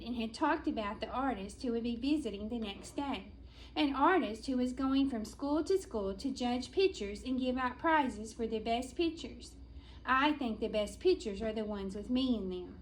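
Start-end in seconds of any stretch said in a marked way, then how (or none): none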